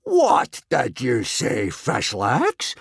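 slow, drawn-out disbelief; astonished roar. A narrator's reading. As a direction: draw the question out in amazement. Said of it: "roared Gobber in astonishment".

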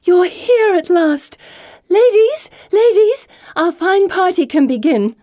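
high, loud, drawn-out delighted squeal; overjoyed hostess calling out. A narrator's reading. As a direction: greet them in a high, excited cry. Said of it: "squealing with delight".

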